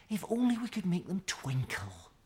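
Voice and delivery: high, wistful little voice; dreamy and hopeful; Mickey's voice. A narrator's reading. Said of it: "she said".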